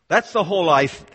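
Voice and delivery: usual speaking voice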